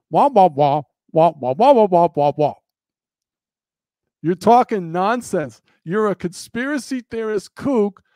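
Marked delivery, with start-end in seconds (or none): 0.1-2.5 louder muffled trombone-like wah-wah babble; mock the pastor hearing you as cartoon grown-up noise; imitating wordless cartoon adult wah-wah; Charlie Brown parents. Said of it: "You sound like this to them".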